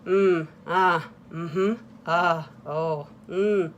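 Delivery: low, bored murmured mm-hmms; dismissive therapist grunts. Retold or imitated. Therapist half-listening to a patient, low bored murmurs. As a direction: grunt along like you're not listening. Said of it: "he'd go".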